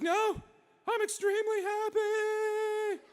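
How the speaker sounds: very high, loud, stretched squeal; ecstatic scream. Acted. President thrilled by the purchase, ecstatic high scream. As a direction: shriek it slowly with overwhelming joy.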